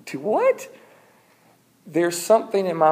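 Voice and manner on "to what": high, rising incredulous question; mock disbelief. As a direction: squeak it upward in shock. Moses shocked at God's sentence.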